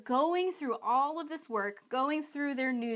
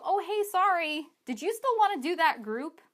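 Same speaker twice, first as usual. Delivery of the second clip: higher, louder fake-casual apology; mock sheepish catch-up; 6 semitones higher, 4 dB louder than usual. Coach voices someone six weeks late following up, mock casual apology.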